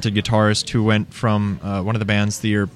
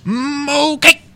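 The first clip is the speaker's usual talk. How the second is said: much higher, drawn-out sales cry; over-the-top Cajun huckster; ecstatic pastry hawker; 12 semitones higher, much slower than usual. Retold or imitated. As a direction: stretch mo pie out with glee. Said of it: "It'd be like".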